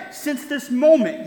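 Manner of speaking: older brother fires back with a smug mock yell. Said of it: "yelled the older brother".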